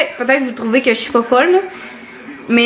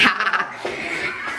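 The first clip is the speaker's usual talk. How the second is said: breathy giggle; 4 semitones lower, 10 dB quieter than usual. Real, not acted. Laughing after cheering the dog.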